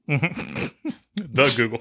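slow, drawn-out teasing repeat; amused parroting of a funny phrase; laughing mock echo. Real, not acted. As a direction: stretch it out while laughing at him.

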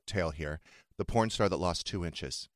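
ordinary delivery

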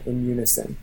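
ordinary talk

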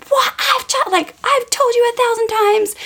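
high-pitched mock scream, held back in volume; retold scream. Mom retelling screaming at her son over bubble bath, mock scream. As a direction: re-enact a parent's exasperated scream without full loudness. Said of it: "I just screamed".

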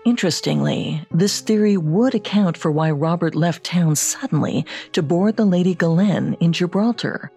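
ordinary talk

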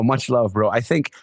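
usual speaking voice

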